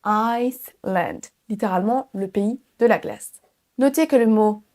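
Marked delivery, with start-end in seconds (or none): 0.1-1.2 Iceland slowly as ICE, then land; audible S. Shown as a demonstration. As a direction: draw out Iceland, S clearly sounded. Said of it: "oui, on entend un son S". (Iceland lentement, avec le son S)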